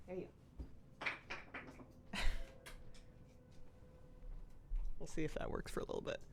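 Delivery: soft whispered praise. Real, not acted.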